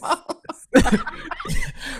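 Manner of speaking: high-pitched laugh. Real, not acted. Host breaks up during the closing remarks, high laugh. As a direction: squeal of helpless laughter.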